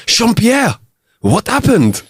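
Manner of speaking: alarmed exclaim. Acted. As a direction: shout out in alarm at the wounded friend. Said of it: "exclaimed Jean-Luc".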